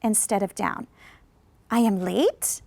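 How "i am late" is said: "late" rises, unsure; surprised doubtful rise; rising question. Shown as a demonstration. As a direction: ask it, lift "late". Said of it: "It sounds like I didn't know".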